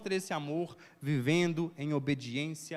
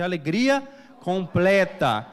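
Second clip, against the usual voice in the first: louder, slow "completa" drawn out; loud call-out; 2 semitones higher, 9 dB louder, slower than usual. Shown as a demonstration. Preacher leading the church to shout alegria, loudly. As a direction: rouse the crowd to shout it back. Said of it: "não, fala aí, gente".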